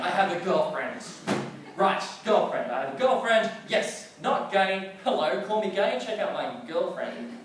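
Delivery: high, loud triumphant boasting; giddy gloating. Retold or imitated. Teen boy skipping home with a new girlfriend, high and triumphant. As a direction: crow it to the whole street.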